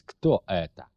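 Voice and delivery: ordinary delivery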